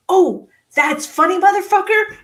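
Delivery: loud, high sarcastic fury; heated outburst, booming punch noises; retold rage. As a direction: act out yelling with each punch. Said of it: "I wanted him dead".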